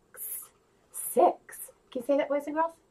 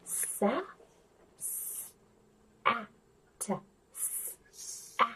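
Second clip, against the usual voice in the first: whispered sounding out; 6 semitones lower, 13 dB quieter, much slower than usual. Shown as a demonstration. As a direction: split the word into sounds in a whisper. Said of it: "sounding out whispered".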